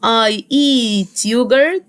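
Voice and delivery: "I eat yogurt" drawn out word by word; slow teacher dictation. Shown as a demonstration. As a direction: dictate slowly to the class. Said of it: "فبقول".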